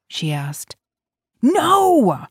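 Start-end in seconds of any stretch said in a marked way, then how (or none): 1.4-2.3 sharp high shout of No; angry cry. A narrator's reading. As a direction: shout it out in anger. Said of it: "he cried out angrily".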